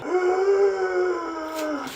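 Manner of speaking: long, high wheeze; wheezing gasp. Acted. Sick boy asked to play, gasping long. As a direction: draw out a strained breath.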